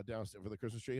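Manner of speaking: usual speaking voice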